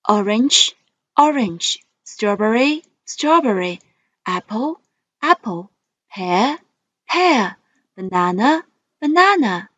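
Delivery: each word twice, rising then falling; list reading tune. Shown as a demonstration. Teacher modelling vocabulary for students, rise then fall. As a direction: model words for the class to repeat. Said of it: "let's read the words".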